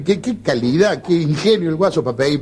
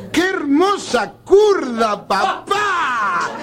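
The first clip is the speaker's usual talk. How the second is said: slow, high, sing-song tipsy drawl; drunk son's slurred praise; 8 semitones higher, much slower than usual. Acted. Son teasing his drunk father, slow and high. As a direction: stretch it out like a happy drunk.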